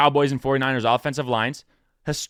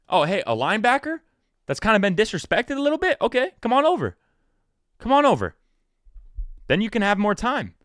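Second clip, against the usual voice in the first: higher, eager come-on-over sales pitch; playful higher recruiting voice; mock recruiter pitch; 7 semitones higher than usual. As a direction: lure the player in like a friendly GM. Pitching a player to sign.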